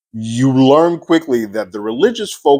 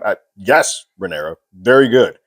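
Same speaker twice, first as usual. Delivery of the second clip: ordinary talk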